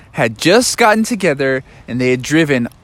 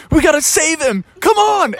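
higher, urgent rallying call; urgent frog; 12 semitones higher than usual. Acted. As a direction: frog urging his friend to rescue Daniel. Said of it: "Froggy's like".

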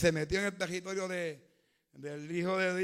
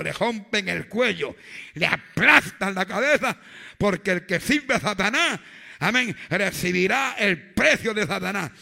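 loud, driving sermon climax; preacher warning the congregation about Satanás, shouting; fiery preaching; 10 dB louder than usual. Acted. Build the sermon to a roaring peak.

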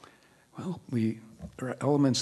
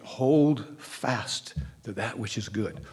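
slow scripture quote; slower than usual. Shown as a demonstration. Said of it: "And there is the statement".

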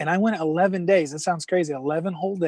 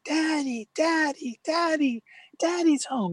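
mock excited scream; 12 semitones higher, slower than usual. Daughters running downstairs to Dad, mock excited screaming. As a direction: imitate excited little kids calling out, much higher.

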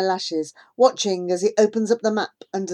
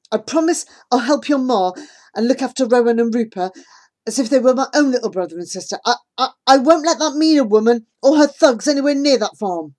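rushed earnest promise; 6 semitones higher, 8 dB louder, faster than usual. Acted.

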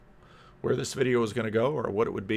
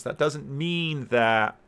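usual speaking voice

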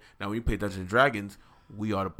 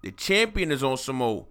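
ordinary delivery

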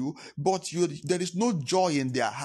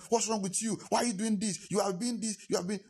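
mock scolding; 8 semitones higher, 4 dB quieter than usual. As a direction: rattle off acted reproaches in a high voice. Acts out scolding his father again.

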